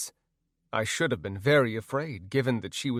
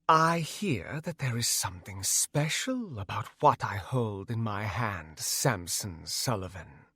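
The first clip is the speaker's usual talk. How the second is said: villain voice; 2 semitones lower, 3 dB quieter than usual. Acted. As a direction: purr it like a gloating villain. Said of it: "Magula said in her best villain voice".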